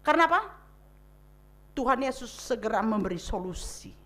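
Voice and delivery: usual speaking voice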